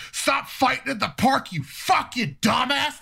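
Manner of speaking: high, yelling mock rage at a little brother; retold scream. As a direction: scream it down at him like an angry big brother. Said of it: "starts screaming at me".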